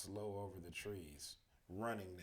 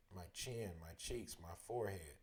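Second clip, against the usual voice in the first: usual speaking voice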